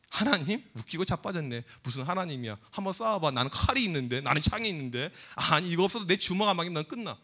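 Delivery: low, quiet, dismissive taunt; dismissive scoffing. Acted. Goliath scoffing at the Lord's name, dismissive. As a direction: brush it off in a low, soft voice. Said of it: "무시했겠죠".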